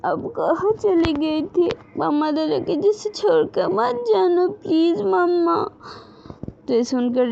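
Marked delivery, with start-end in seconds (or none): 0.1-5.7 put-on crying child. A narrator's reading. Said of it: "रोते बोलते".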